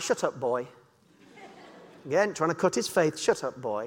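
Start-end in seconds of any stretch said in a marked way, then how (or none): 0.0-0.7 low, quiet scornful grumble; gruff brush-off. Retold or imitated. Soldiers shushing the shepherd boy, low scornful grumble. Soldiers silencing young David. 3.2-3.8 low, quiet scornful grumble; gruff brush-off. Retold or imitated. Soldiers shushing the shepherd boy, low scornful grumble. Soldiers silencing young David again.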